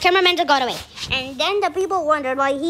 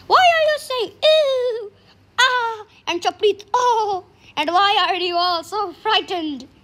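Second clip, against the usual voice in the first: put-on higher-pitched questioning boy; child character; 5 semitones higher than usual. Boy asking the frightened villagers why, high child voice. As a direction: start high, stretching the quoted cries. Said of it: "I'll be the child".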